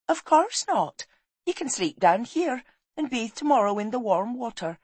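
high, brisk refusal from a firm wife; bossy wife. Acted. Wife banishes husband from bed until bathed, bossy.

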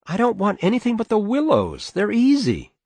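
very high-pitched wife's appeal; pleading mother. Acted. Mother begging father for willows, high pleading. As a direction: coax him in a high voice.

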